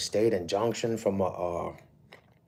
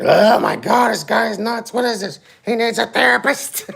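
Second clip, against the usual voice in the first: much higher, louder mocking voice of a stranger; mock viewer reaction; 12 dB louder than usual. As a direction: squeal it like a scandalised onlooker. Imagined viewer mocking the speaker.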